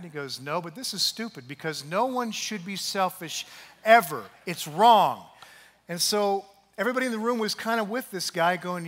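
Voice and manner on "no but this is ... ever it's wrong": loud, irritated pushback; mildly annoyed insistence. Retold or imitated. Angry man arguing with Derek, irritated. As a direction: dig in and get annoyed.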